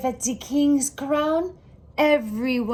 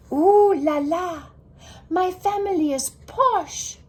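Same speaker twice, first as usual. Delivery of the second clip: a higher, louder thrilled ooh la la; delighted; 7 semitones higher, 5 dB louder than usual. A narrator's reading. Girl admiring her family's new accessories, thrilled and gushing. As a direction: gush with delight.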